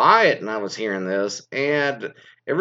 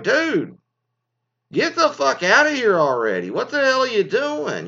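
mock-furious shout at the sneezing guy; exasperated yell; 10 semitones higher than usual. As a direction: tell the sneezer off, fully fed up. Fed up with sneezing diner.

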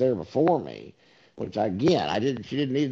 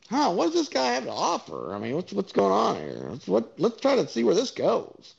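higher, hammed-up interested musing; mock curiosity; 8 semitones higher than usual. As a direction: play someone naively intrigued, pitched up. Mocking someone who would stay.

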